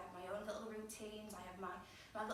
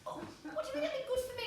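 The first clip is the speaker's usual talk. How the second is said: fast, high, incredulous question; indignant, pitched right up; offended retort; 12 semitones higher, 4 dB louder, much faster than usual. Acted. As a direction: snap back as if insulted. Acts her offended retort to him.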